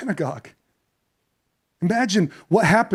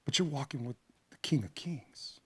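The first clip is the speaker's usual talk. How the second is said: hushed, reverent whisper; awed whisper; 7 semitones lower, 16 dB quieter than usual. Acted. Pastor reminding the grieving father who walks beside him, whispered. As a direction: let it land softly, in awe. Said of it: "whispered reminder".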